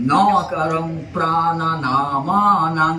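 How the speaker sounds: syllable by syllable, very slow; staccato. Shown as a demonstration. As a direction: chop each syllable apart slowly. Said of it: "আমি কেটে কেটে বলছি, তোমরা বলো".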